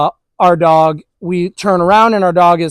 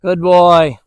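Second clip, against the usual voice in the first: calm praise; 2 semitones lower than usual. Real, not acted. Praising dog after long pause.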